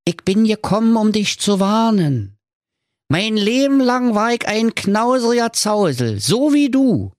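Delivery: a dead partner come to warn; ghostly business partner giving a warning. A narrator's reading. Ghost warns miser.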